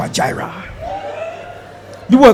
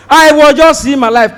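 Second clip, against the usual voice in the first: ordinary talk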